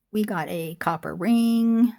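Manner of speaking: normal talking voice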